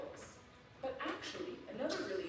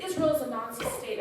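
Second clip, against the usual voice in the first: retold protest slogan; 4 semitones higher, 7 dB louder than usual. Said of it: "They said".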